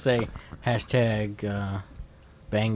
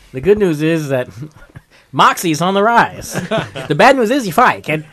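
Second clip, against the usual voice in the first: high, loud laughing vaudeville voice; old-timey voice; 6 semitones higher, 8 dB louder than usual. Pretend. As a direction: deliver it like a cheerful old-time showman. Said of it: "Just do it old-timey".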